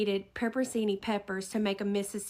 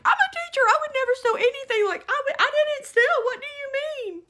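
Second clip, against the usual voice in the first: very high, loud, hurried protest; frantic fast pleading; 6 dB louder than usual. Retold or imitated. Shopper pleading innocence to accuser, retold frantic fast plea. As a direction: tumble through the denials, desperate. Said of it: "pleading to this woman as fast as I can".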